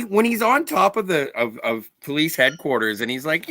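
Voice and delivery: normal talking voice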